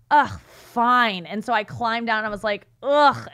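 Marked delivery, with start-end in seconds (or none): 0.1-1.2 grudging huff. Retold or imitated. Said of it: "And I go". 2.8-3.3 disgusted groan. Retold or imitated. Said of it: "I did the same reaction".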